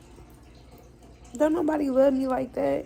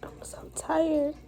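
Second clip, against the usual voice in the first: higher, drawn-out whisper, worn out; exhausted whisper; 6 semitones higher, slower than usual. Real, not acted. Alone after a bad day, whispering how tired she is. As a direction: let it slip out slowly, drained.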